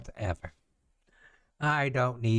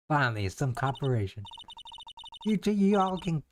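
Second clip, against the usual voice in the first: normal talking voice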